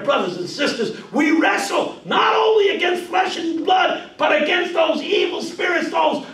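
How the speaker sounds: loud, high shouting sermon; full preaching. Shown as a demonstration. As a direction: build to a thundering cry.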